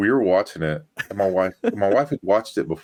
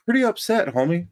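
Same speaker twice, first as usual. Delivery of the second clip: pretty upset; 12 semitones higher, faster than usual. Retold or imitated. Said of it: "And I'm like".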